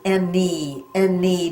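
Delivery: "any" slowly split, en-nee, ending held; drawn-out final E. Shown as a demonstration. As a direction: stretch the ee at the end of "any". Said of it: "Do you hear the E at the end?".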